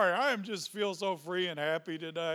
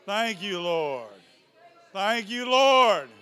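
rising praise; 3 semitones higher, 10 dB louder, slower than usual. Real, not acted.

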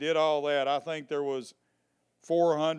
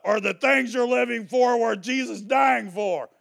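loud, higher shouted quote; shouted sign slogan; 8 semitones higher, 7 dB louder than usual. Retold or imitated. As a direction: proclaim it like a protest sign. Reading out her sign, shouted.

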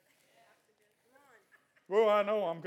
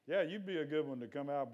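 ordinary talk, nothing marked

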